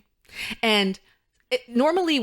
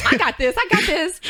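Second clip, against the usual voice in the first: higher, mock-confident self-quote; cocky; 9 semitones higher, 4 dB louder than usual. Retelling snacking instead of rehearsing, mock cocky. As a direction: imitate your cocky younger self bragging. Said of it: "I was cocky".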